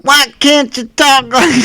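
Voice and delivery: high-pitched bad celebrity impression; hammed-up, high put-on comedian voice; Richard Pryor. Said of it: "Richard Pryor trying to do Bill Cosby".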